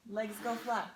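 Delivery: usual speaking voice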